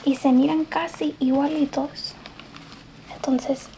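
usual speaking voice

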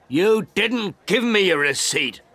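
high, loud angry shout; old man yelling at the photographer. A narrator's reading. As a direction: yell it at him in outrage. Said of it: "I shouted".

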